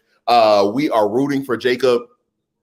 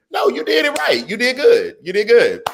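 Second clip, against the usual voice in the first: reassuring; 8 semitones higher than usual. Real, not acted. Reassuring a commenter.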